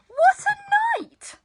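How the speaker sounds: ordinary delivery, nothing marked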